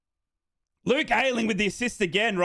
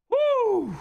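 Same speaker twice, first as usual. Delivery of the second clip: joyful whoop; 13 semitones higher than usual. Real, not acted. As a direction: whoop with delight.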